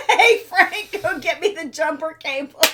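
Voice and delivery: loud, high holler with laughter; mock calling out. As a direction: holler to a helper, joking. Joking call to an imagined helper.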